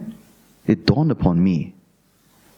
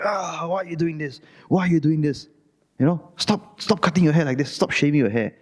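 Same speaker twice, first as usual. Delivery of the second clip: nagging mother; 6 semitones higher than usual. Retold or imitated. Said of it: "My mother was like".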